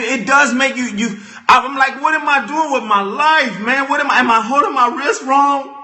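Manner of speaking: ordinary delivery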